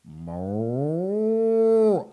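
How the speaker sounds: loud long mooing cow. Acted. Reading to kids, mooing like the cow. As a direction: moo out like a cow. Story reading, animal noises.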